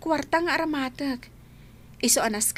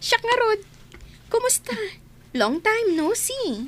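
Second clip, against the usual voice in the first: excited greeting; 9 semitones higher than usual. Acted. Happy reunion greeting.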